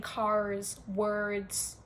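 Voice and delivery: CARS and WORDS drawn out with American Rs; American accent. Retold or imitated. Teasing foreigners who have turned American, imitated American accent on CARS, WORDS. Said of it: "have genuinely changed into American".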